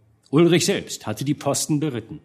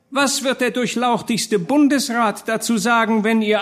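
high, thin refined orator; fine-voiced call; 10 semitones higher than usual. A narrator's reading. Elegant gentleman warns burghers about the council, fine high voice. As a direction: appeal in a high, delicate voice. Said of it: "rief er mit feiner Stimme".

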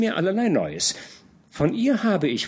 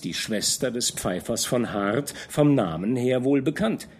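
usual speaking voice

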